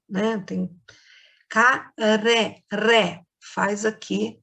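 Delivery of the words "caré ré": "caré, ré" with the tongue flicking on the R; soft tapped R. Shown as a demonstration. Teacher demonstrating the weak R to pupils, "caré, ré" slowly with tapped R. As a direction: separate and stretch "caré, ré", R lightly trembled on the tongue. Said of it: "uma tremidinha na língua da gente".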